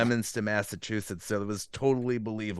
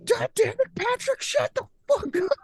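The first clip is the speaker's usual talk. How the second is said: very high, strained mom screech; screaming mother; 3 dB louder than usual. Retold or imitated. Mother to son over the phone, screaming. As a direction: imitate a furious mother screaming at her kid. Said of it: "she's screaming on the phone".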